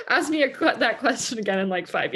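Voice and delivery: real laughter breaking into the words; laughing. Real, not acted. Guest jokes about future guilt over kids, laughing.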